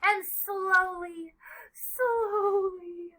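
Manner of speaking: high, lingering storyteller's voice; unhurried singsong narration; slow, drawn-out. A narrator's reading. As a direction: stretch each "slowly" right out. Word acted out by drawn-out delivery.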